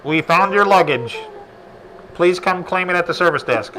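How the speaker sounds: loud PA announcer voice; airport announcement. Pretend. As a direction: announce it over the terminal speakers. Mock airport lost-luggage announcement.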